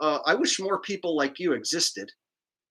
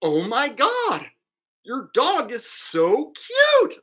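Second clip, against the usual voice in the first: gushing passerby; 7 semitones higher, 4 dB louder, slower than usual. Retold or imitated. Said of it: "people are like".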